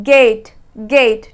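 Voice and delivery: गेट slowed down and pitched low, the ay drawn out; teacher leading a repeat-after-me drill. Shown as a demonstration. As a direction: say गेट slowly for the class to repeat. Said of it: "आप भी बोलिए साथ साथ गेट".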